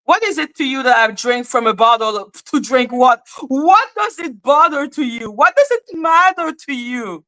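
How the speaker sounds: high-pitched, exaggerated indignation; indignant challenge. Challenging critic over bottles.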